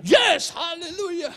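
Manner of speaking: loud, high, slow yes hallelujah; enthusiastic shout. Retold or imitated. Preacher shouting along with the crowd, enthusiastic. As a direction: belt it out with total enthusiasm. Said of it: "antusias sekali".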